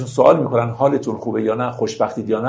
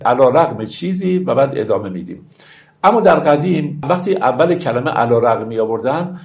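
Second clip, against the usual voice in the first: normal talking voice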